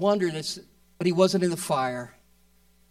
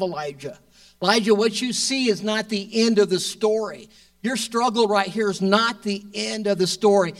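louder, driving sermon delivery; preaching hard; 3 semitones higher, 5 dB louder than usual. Real, not acted. Preacher rallying the congregation, loud and fervent. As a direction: push it out with building fervour.

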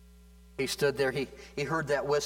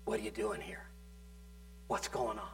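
near-whisper, much softer, as God's quiet voice; still small voice; 8 dB quieter, faster than usual. Acted. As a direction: let it fall to a hush, soft and close. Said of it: "still small voice".

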